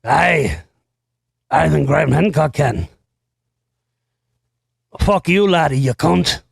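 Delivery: amateur attempt at a Scottish accent; comic Scottish impression; mock Scottish accent. Shown as a demonstration. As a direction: put on a rough-and-ready Scots accent. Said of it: "I could do a more convincing Scottish accent".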